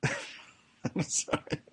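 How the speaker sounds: breathless giggly whisper through laughter; laughing apology. Real, not acted. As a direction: barely get it out while laughing.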